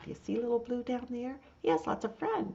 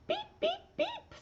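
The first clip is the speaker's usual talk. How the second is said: squeaky beeps pitched way up; high truck beep. Acted. Little Blue greets his friends, high squeaky beeps. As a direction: beep like a tiny toy truck horn. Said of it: "says Little Blue".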